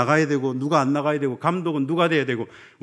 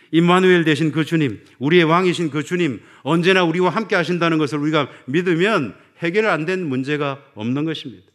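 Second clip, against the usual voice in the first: louder proclamation of the Lord; fervent preaching; 3 semitones higher, 7 dB louder than usual. Acted. The pastor proclaiming to his flock, loud and fervent. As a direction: proclaim it with ringing conviction.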